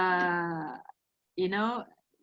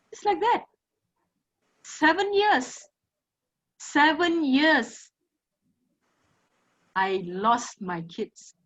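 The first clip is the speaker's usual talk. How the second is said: tearful; 4 semitones higher, slower than usual. Real, not acted. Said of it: "I'm sorry, I'm crying again".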